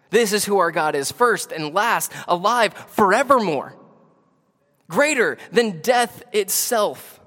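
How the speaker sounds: building sermon climax; impassioned preaching. Real, not acted. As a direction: drive each phrase home to the congregation. Preaching at full force.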